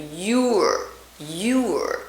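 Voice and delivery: "Your" with a round, very tight oo; careful "yoor". Shown as a demonstration. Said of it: "It's very tight".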